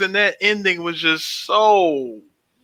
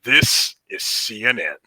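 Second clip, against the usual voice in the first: deep announcer impression; low, weighty network-ident voice; James Earl Jones; 7 semitones lower than usual. One more impression for his cohost, deep announcer voice. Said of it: "I got one more for you".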